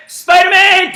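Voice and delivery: high, loud shout; called-out command. Real, not acted. Coach calling the Hulk command: big excited shout. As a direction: bark it out to the class.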